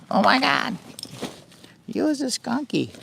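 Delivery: high, cooing pet voice. Real, not acted. Coos baby talk at the skunk wandering by.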